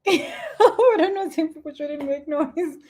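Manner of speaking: high, slowed by laughter; amused. Real, not acted. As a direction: talk through a real giggle. Laughs at her own remark.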